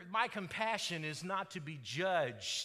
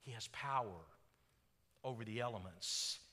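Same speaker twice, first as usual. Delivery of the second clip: hushed preaching; 8 semitones lower, 7 dB quieter than usual. Acted. Preacher telling how the storm subsided into peace, hushed. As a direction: let it sink gently into stillness.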